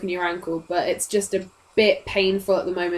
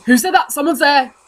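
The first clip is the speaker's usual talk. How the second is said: loud, raised challenge from a guard; guards shouting; 5 semitones higher, 6 dB louder than usual. Acted. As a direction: shout the alarm to the other guard.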